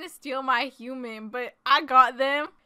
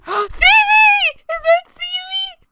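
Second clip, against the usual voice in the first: very high, loud, drawn-out cry; excited shriek; 8 dB louder, slower than usual. Acted. Player thinks lost pet human is back, excited shriek. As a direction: squeal it slowly in disbelief.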